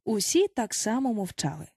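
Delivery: usual speaking voice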